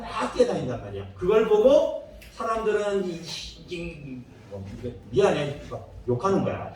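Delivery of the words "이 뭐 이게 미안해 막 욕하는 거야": ordinary talk, nothing marked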